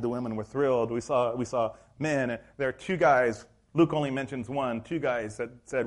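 ordinary talk

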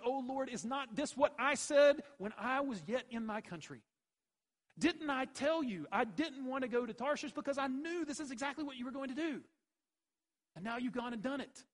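loud, high, furious prayer; angry complaint. Acted. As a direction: throw the complaint at God. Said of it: "And he was angry".

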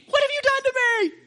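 the preacher mimics King Balak, dismayed and high-pitched; outraged king. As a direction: squeal it like a cheated king. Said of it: "this Moabite king".

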